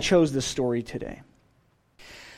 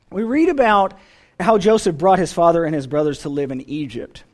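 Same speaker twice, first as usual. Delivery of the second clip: usual speaking voice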